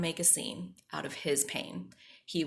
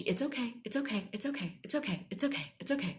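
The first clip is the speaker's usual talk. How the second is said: higher-pitched, rapid-fire repeated brush-off; dismissive reassurance; 6 semitones higher, faster than usual. Retold or imitated. Grandma refusing the child's apology, brisk reassurance. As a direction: imitate a grandma waving an apology away. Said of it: "she's not gonna accept my apology".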